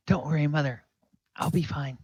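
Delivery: high-pitched brave little lad; plucky kid reassurance; reassuring young boy. Acted. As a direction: comfort mother in a child's voice. Boy reassures his mother.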